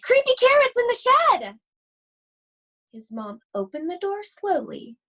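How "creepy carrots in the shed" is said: very high, loud terrified yell; panicked scream. Acted. Rabbit screams to mom about the shed. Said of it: "Jasper screamed".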